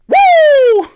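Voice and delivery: high, loud Flair-style woo; wrestling whoop. Pretend. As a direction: let out a wild wrestler's whoop.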